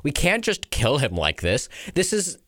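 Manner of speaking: high, pained objection; anguished reluctance, pitch climbing; distressed protest. Acted. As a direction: plead against killing a comrade. Soldier objects to mercy killing.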